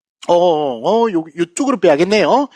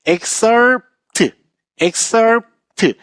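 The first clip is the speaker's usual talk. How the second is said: excerpt with the weight on EX, falling after; stress on first syllable; 4 semitones higher, much slower than usual. Shown as a demonstration. Teacher showing students where excerpt is stressed. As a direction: say excerpt as EX-cerpt, front stressed. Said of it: "앞에 강세가 있고요".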